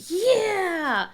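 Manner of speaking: high, loud eager yeah; eager kid yeah. Retold or imitated. Mom imitating son eager to help make hamburgers, high eager. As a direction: jump at it. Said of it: "Michael's like".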